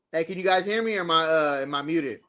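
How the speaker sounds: ordinary delivery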